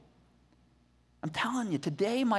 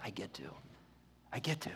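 hushed awe; 14 semitones lower, 17 dB quieter, faster than usual. Retold or imitated. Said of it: "He's like".

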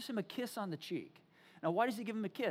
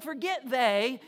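louder, impatient dismissal; frustrated; 7 dB louder, slower than usual. Acted. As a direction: brush it aside with acted frustration. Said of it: "He gets all frustrated".

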